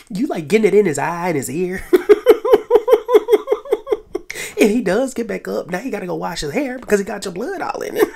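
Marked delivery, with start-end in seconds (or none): 1.9-4.5 loud high-pitched cackle; high squealing laugh. Real, not acted. Cracking up at the blood scene, a loud high squeal of laughter. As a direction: let a loud shrieking laugh burst out. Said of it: "that was so funny".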